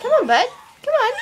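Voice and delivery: high sing-song pet talk; coaxing a wild bird. Real, not acted. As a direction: urge the bird on sweetly.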